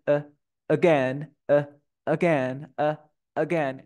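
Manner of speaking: slow demo of "eh", then "again" with that same schwa; isolated schwa, then word. Shown as a demonstration. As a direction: keep the tongue still on "eh" and the A of "again". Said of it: "Ni siquiera se mueve". ("eh, again": schwa aislada y luego en la palabra, sin mover la lengua)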